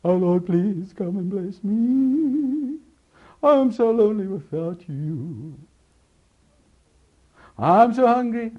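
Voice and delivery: high, slow, pleading mock prayer, rising to a cry; mock emotional prayer. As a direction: parody a gushing, needy worshipper begging God. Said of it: "it's just emotions".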